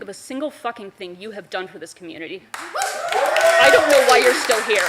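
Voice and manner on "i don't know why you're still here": slow, loud, cold contempt; cutting remark. Real, not acted. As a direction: drag it out, louder and louder in disgust. Angry public comment to councilman.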